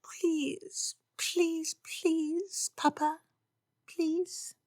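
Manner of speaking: high, soft whimpering pleas. Acted. Lydia whimpers at Papa, high soft pleading. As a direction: beg like a whimpering puppy.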